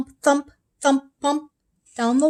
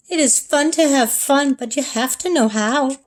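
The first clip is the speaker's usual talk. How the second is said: usual speaking voice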